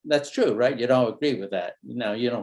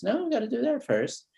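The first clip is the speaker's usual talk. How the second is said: a mother laying down the rule, pitched much higher; mother's voice; 10 semitones higher than usual. Retold or imitated. Mom refusing her son, said higher. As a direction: mimic a mom insisting chores come first. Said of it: "And then she says".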